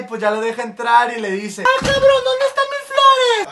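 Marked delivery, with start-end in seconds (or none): 1.6-3.5 much higher, slow demanding wife; wife's voice. Acted. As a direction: demand the flowers in falsetto.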